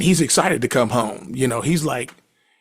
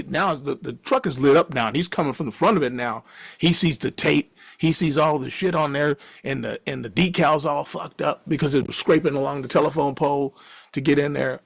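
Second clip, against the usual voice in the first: ordinary talk, nothing marked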